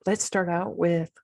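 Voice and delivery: usual speaking voice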